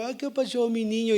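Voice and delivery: high-pitched cooing to a little grandson; doting grandfather. Acted. Grandfather greeting his little grandson, doting. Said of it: "le hablo a mi nieto".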